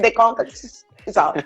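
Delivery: normal talking voice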